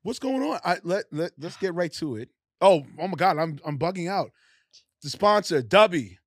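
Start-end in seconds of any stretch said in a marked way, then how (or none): none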